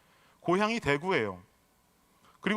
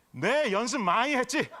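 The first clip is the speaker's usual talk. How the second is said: slow, higher-pitched mock Gyeongsang lilt; Gyeongsang dialect; 4 semitones higher than usual. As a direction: answer proudly in imitated Gyeongsang dialect. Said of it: "자체가 사투리 아닙니까?". (느리고 높게 흉내 낸 경상도 사투리)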